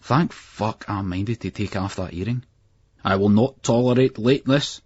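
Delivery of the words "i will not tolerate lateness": higher, louder, clipped authority; stern teacher. A narrator's reading. Headmaster scolding the late boys, stern. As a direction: lay down the law. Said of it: "Blackie went".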